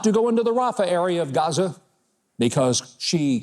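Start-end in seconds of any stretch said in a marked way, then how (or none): none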